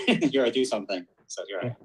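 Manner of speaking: ordinary talk, nothing marked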